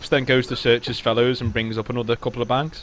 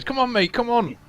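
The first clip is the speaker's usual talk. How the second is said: much higher urgent plea to a mate; pleading call; 11 semitones higher than usual. Acted. Fleeing soldier urging his mate, high and urgent. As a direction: beg your friend to hurry. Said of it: "turns around and goes".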